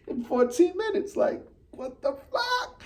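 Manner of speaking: high, soft, squeaky disbelief, drawn out; can't believe what he's seeing; stunned disbelief. Real, not acted. Fan reliving the blown lead, high and softly stunned.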